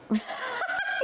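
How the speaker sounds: sudden high laugh that breaks off the story; burst of laughter. Real, not acted. Laughing in disbelief at her friend's news about the ex.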